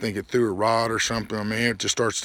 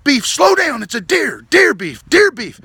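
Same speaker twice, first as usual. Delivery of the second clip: much higher, very loud panicked yells; panicked screaming; 14 semitones higher, 10 dB louder than usual. Retold or imitated. Passenger screaming at the driver about the deer. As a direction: scream at him before impact. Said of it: "I'm like".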